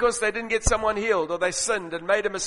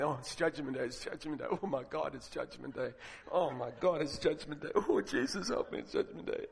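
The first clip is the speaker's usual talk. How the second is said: soft, fretful muttering, fading to a breath; nervous muttering; 6 semitones lower, 12 dB quieter than usual. Retold or imitated. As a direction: mutter it anxiously to yourself. Nervous soul waiting in queue.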